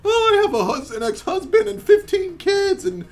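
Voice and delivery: very high, drawn-out falsetto woman; mock needy woman. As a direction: wail it slowly in mock self-pity. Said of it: "psychopath loser bitches who are just like".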